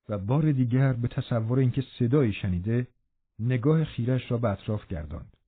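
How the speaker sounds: ordinary delivery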